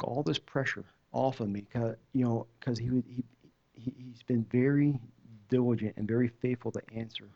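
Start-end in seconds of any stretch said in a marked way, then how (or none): none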